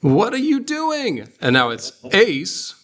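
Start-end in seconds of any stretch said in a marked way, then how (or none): 0.0-1.3 high, sassy demand; indignant high-pitched question; with attitude. Shown as a demonstration. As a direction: challenge them, pitched way up. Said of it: "with some attitude".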